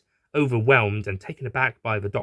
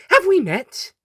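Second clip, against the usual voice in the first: very high, louder bright question; high doctor voice; 7 dB louder than usual. Acted. Doctor asking the horse, very high and bright. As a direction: ask it chirpy and way up.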